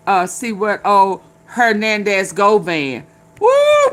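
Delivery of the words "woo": high, loud whoop; excited woo. Real, not acted. Streamer hyping up old drama to chat, high loud whoop. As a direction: let out a hyped whoop.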